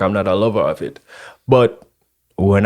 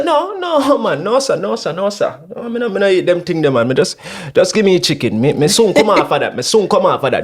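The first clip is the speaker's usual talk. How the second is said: high, pleading no, sir; refusing pork; 6 semitones higher than usual. Retold or imitated. He refuses pork at the jerk hut, high and pleading. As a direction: beg off the pork, pitched up. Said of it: "I said".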